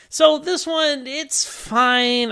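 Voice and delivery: high, mock-bright shrug of a voice; meh verdict. Real, not acted. Host giving the episode a lukewarm verdict, high and mock-cheery.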